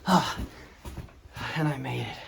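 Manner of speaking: nervous climbing, creaky steps; climbing stairs. Real, not acted. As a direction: climb and gasp at the top. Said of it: "These stairs are creaking under me".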